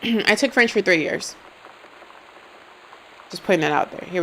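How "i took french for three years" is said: ordinary talk, nothing marked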